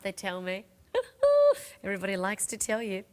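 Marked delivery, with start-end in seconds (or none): 0.9-1.8 a short high laugh at herself; bright burst of laughter. Real, not acted. Host laughing at what people keep telling her. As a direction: laugh it off, amused.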